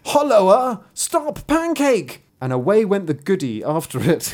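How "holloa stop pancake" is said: high-pitched storybook shout; high, loud chasing cry; calling out. A narrator's reading. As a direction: holler after a runaway. Goodie calls after runaway pancake.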